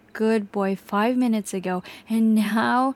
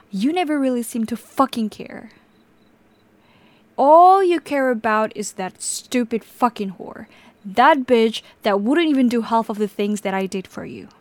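louder and louder, spitting the insults; jealous rage; 6 dB louder than usual. Acted. Captor raging at the victim about the other woman, furious. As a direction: rant in bitter jealous fury.

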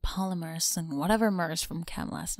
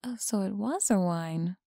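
ordinary talk

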